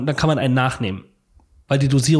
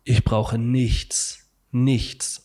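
very slow, drawn-out, lower voice; blissful needing nothing; 4 semitones lower, much slower than usual. Retold or imitated. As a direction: savour each word as if floating. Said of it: "und denk so".

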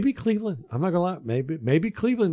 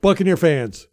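loud, raised proclamation; rallying call; 6 semitones higher, 6 dB louder than usual. Real, not acted. Host telling Buccaneer fans about the playoffs, rallying call. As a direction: announce it loudly to the fans.

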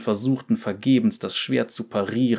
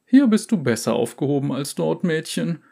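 higher condescending trader; merchant voice; 6 semitones higher than usual. Acted. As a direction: patronize the girl lightly. Merchant advises girl.

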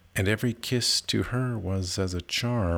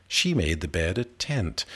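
plain reading voice

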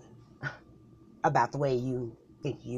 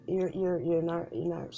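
normal talking voice

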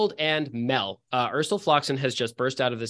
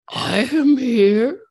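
much higher, slow and louder, ghostly; high floating voice; 10 semitones higher, 6 dB louder, much slower than usual. Acted. Unseen voice answers the cloaked figure, high and floating. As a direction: let the voice float high and eerie. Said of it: "floating high on this dark air".

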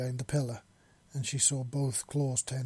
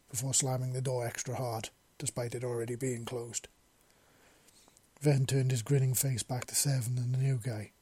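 ordinary delivery

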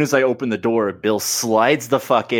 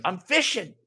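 higher, softer mock voice of careless Johnny; 9 semitones higher, 6 dB quieter than usual. Johnny fishing while the team is under attack, mock voice. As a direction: play Johnny as unbothered. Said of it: "And he's like".

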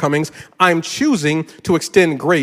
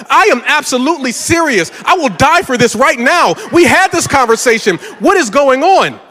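higher and louder, hammed-up indignation; mock outrage; 7 semitones higher, 4 dB louder than usual. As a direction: insist like your life depends on it. Retelling heated argument with wife.

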